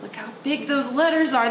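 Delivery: plain reading voice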